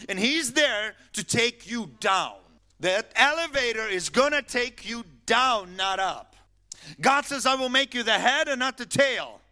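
loud, high-pitched sermon delivery; impassioned preacher's warning; full-force preaching. Real, not acted.

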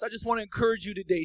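fiery preaching. Acted. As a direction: preach it out to the whole room. Preaching at full force.

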